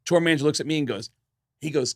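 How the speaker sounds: usual speaking voice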